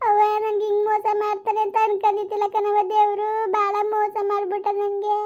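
high, drawn-out weeping complaint; wailing daughter. Acted. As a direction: wail it out like a ruined young wife crying to her mother. Daughter weeps over her fate.

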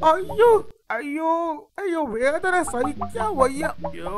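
high wailing ouch; groaning in pain. Acted. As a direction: whine loudly in pain. Hyena in pain.

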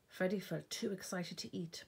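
ordinary delivery

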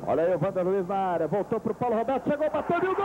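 fast building goal call; live play-by-play. Real, not acted. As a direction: race through the attack.

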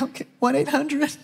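teary whisper-soft phone call; crying. Retold or imitated. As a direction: choke it out through tears. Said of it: "So I'm crying".

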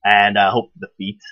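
ordinary talk, nothing marked

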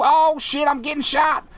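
normal talking voice

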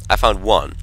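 usual speaking voice

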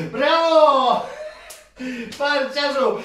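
loud, high shout of praise; cheering bravo. Real, not acted. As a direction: cheer him on at full voice. Son wins the prize. (głośne, wysokie „Brawo!” dla syna)

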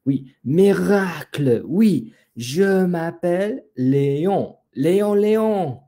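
joyful exclamation. A narrator's reading. The peacock finally learns his own name, joyful exclamation. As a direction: exclaim it as if a miracle has happened. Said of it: "s'exclama le pont".

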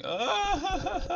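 high-pitched cackle; high laugh. Real, not acted. Streamer laughing at his sprinting face. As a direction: laugh at yourself.

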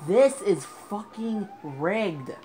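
loud, slow, drawn-out rage; slow angry complaint. Real, not acted. Gamer accusing the game, slow angry rant. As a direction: spell out your fury.

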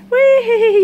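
high, loud playful whee. Real, not acted. Owner swooping the bird around, loud playful whee. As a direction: make it a fun ride.

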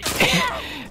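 incredulous laugh. Real, not acted. Laughs at enemy.

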